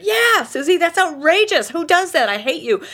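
high, loud mock outrage at Susie; indignant outburst. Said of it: "And she said".

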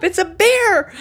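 high, loud excited cry; excited shout. Retold or imitated. Wife telling husband she sees a bear, loud excited shout. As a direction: announce the bear at full voice.

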